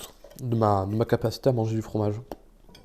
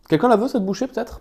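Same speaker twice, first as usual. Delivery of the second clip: high playful offer; 10 semitones higher, 5 dB louder than usual. Acted. Offering the bite to chat.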